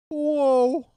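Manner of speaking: very high, loud sitcom whoa; Joey Lawrence whoa. Shown as a demonstration. Streamer answers the super chat with a loud, very high Joey Lawrence whoa. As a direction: squeal it out like a nineties sitcom catchphrase. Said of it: "You wanted the Joey Lawrence".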